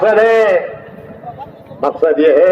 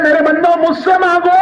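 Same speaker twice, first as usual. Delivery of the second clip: higher, imploring voice of God; raised voice of God calling His servants; divine call; 7 semitones higher than usual. Acted. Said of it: "अल्लाह कहता है".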